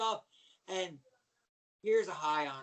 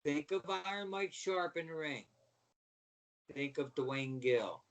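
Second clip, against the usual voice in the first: usual speaking voice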